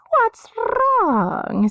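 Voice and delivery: very high, strange voice for the madwoman Dirna; high eerie madwoman voice. A narrator's reading.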